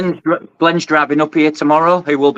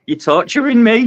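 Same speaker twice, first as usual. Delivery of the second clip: mock torment; 7 semitones higher than usual. Gorgeous fish shown on stream.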